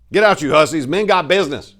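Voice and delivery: loud, high yell at the women; furious shout from a man clearing the room. A narrator's reading. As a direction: bellow them out of the room. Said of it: "he yelled at the rest of the women".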